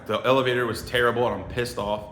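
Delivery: normal talking voice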